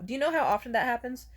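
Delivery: usual speaking voice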